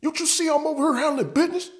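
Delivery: high falsetto indignant buddy; high mock coworker telling him off; mocking angry coworker. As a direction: snap back in a high put-on voice. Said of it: "and he was like".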